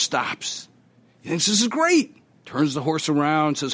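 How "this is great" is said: high, gleeful exclamation; delighted. Acted. Rider thrilled with his new horse, a high gleeful cry. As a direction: squeal it like a kid with a new toy.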